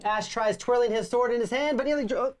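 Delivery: storybook reading voice; story narration. Acted. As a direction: read it like a fantasy tale. Reads game story narration.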